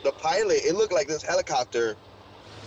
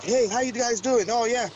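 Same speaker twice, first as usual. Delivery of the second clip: put-on much higher, breezy American accent; imitating the Nordics' American accent; 8 semitones higher than usual. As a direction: play the alien speaking casual American English. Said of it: "their English was so perfect".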